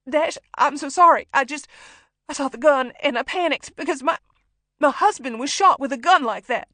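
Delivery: high, loud, tearful apology; desperate explanation through tears; panicked pleading. A narrator's reading. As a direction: blurt out an excuse in terror. Hostage pleads with gunman, lying desperately.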